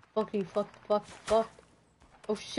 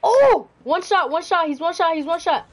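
loud urgent shouting, rapid repeats; excited callout; 5 semitones higher, 6 dB louder than usual. Real, not acted. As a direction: call it out fast and loud. Enemy nearly dead, urgent callout.

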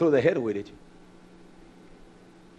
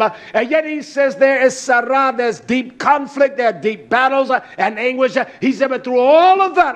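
impassioned, driving sermon delivery; full-force preaching; 10 semitones higher, 10 dB louder than usual. Real, not acted. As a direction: preach it out with punched key words.

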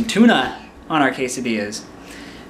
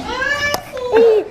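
calling out high; 3 dB louder than usual. Real, not acted. Calling kid over.

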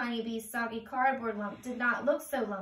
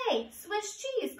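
much higher, slow teasing call; Butterfly calling; 9 semitones higher than usual. Acted. Butterfly calling out to the boxed friends. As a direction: sing it out high and slow. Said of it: "called Butterfly".

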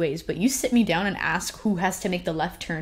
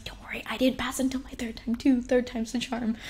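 higher hushed whisper of comfort; mom comforting; 6 semitones higher than usual. Retold or imitated. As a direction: soothe her gently, half whispered. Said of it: "my mom was like".

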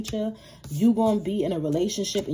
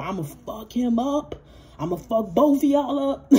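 mock threat; 3 semitones higher than usual. Jealous ex threatening Libra and the new man, building mock menace. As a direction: menace them, then let it rise. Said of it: "this is how this person really feel".

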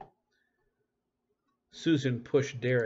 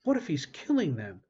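alarmed; 5 semitones higher, 5 dB louder than usual. A narrator's reading. Lyle fearing for the boys.

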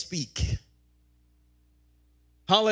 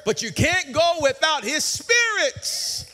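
raised, higher ringing cry; preaching shout; 8 semitones higher, 4 dB louder than usual. Real, not acted. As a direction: shout it to the congregation. Preaching climax.

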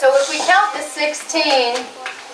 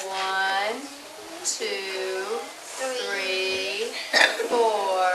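quiet counting; 3 semitones lower, 9 dB quieter, much slower than usual. Shown as a demonstration. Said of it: "let's count together".

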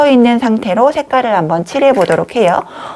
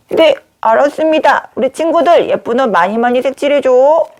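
higher-pitched pretend doll reply; paper doll voice; 4 semitones higher than usual. Paper doll asks the children to colour clothes, pretend doll voice. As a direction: play an obliging little doll, pitched up.

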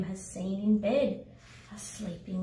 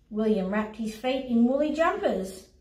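usual speaking voice

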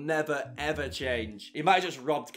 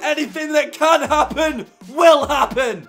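higher and louder, still buzzing; euphoric; 11 semitones higher, 10 dB louder than usual. Real, not acted. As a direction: rave about the story, near shouting. Euphoric after the late winner.

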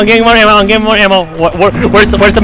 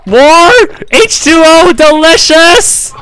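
higher and slow, relishing each word; silly savoring voice; 6 semitones higher, slower than usual. Pretend. Gamer hamming it up over in-game water, mock savoring. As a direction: ham up a goofy water commercial. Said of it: "Why the heck did I just say H two O delicious".

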